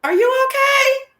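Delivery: lightly voiced shocked viewer, high; high mock concern. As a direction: play a fan asking if she's okay. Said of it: "them hoes might be saying".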